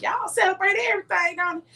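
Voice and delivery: high-pitched mock amazement; retold incredulous question. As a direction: imitate a friend's surprised question. Acting a friend's teasing question.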